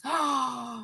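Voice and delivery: quick shocked intake of breath; sharp gasp. Real, not acted. Shocked reaction while reading news.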